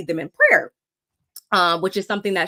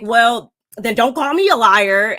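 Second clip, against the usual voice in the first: retold angry retort; 2 semitones higher, 7 dB louder than usual. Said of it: "Whitney's like".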